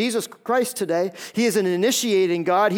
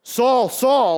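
raised, emphatic call from heaven; Jesus; 4 semitones higher, 4 dB louder than usual. Acted. Jesus calling Saul's name twice, booming. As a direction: call the name out as if through a megaphone. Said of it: "it's Jesus behind the megaphone".

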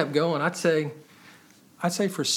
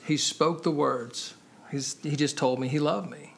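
ordinary talk, nothing marked

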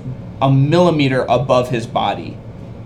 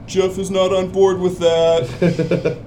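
slow, higher little goblin; small goblin voice; 5 semitones higher, much slower than usual. Acted. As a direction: drawl it out as a tiny goblin. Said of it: "you hear a smaller voice say".

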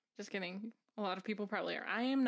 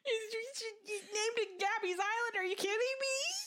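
high, crying laugh; teary laughing; 11 semitones higher than usual. Retold or imitated. Describing the gazebo scene that made her cry: teary laughing. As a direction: laugh and cry at once over something sweet.

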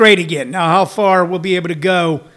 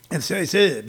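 ordinary talk, nothing marked

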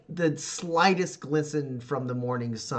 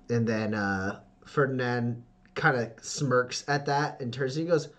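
usual speaking voice

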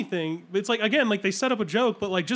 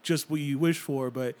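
movie narrator; 4 semitones lower than usual. Retold or imitated. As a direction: intone it like a film's narrator. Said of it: "She's the, narrating the movie".

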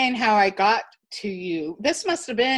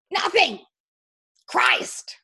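emphatic shout; 3 semitones higher, much slower than usual. Retold or imitated. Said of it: "he was so emphatic about this".

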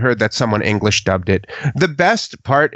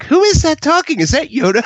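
surprised; faster than usual. Retold or imitated. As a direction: sound startled and confused. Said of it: "I was like".

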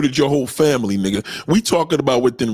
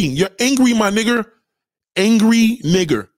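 ordinary talk

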